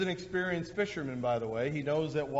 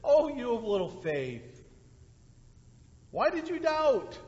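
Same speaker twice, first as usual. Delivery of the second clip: gentle tender reading; 7 semitones higher than usual. Shown as a demonstration. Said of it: "That's what I think the response here by Jesus is".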